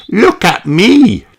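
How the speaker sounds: high, loud, slow proud call; loud, high showing-off shout. Acted. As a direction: shout to be noticed by her friends. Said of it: "shouts Peppa".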